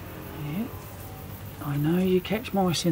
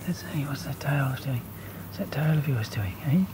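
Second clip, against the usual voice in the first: soft whisper to the cat; 7 semitones lower, 7 dB quieter than usual. Real, not acted. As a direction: hush it as if not to startle her.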